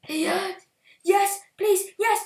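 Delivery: high, loud cheering; excited shouting. Real, not acted. Urging the pig into the pen, excited loud cheering. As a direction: cheer it on at the top of your voice.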